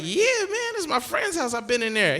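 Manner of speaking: high-pitched, casual defence; defensive. Retold or imitated. Grandson defends himself, acted.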